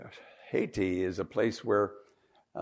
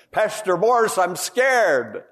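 frightened plea; 8 semitones higher, 8 dB louder, slower than usual. Retold or imitated. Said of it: "He told me, he said".

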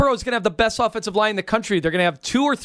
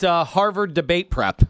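ordinary talk

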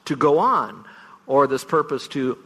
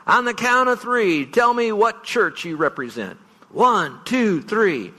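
mock stadium call; 8 semitones higher, slower than usual. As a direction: call it out over a stadium crowd. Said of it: "and say".

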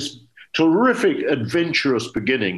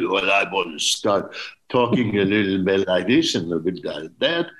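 put-on French accent; 4 semitones lower than usual. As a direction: slip lightly into a French accent to show the director. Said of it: "I didn't ask you if you can do a French accent".

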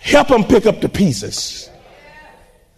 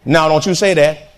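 normal talking voice

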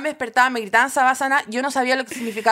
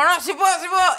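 dazed insistence; 5 semitones higher than usual. Acted. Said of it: "como recién desmayada".

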